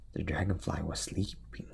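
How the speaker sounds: soft unvoiced whisper; whispered narration. A narrator's reading. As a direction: hush so the dragonfly stays asleep. Whispering so dragonfly stays asleep.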